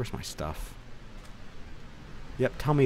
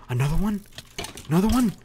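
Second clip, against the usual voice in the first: higher and louder on each question; rising, disbelieving outburst; incredulous; 9 semitones higher, 8 dB louder, faster than usual. Real, not acted. Another enemy appears in game.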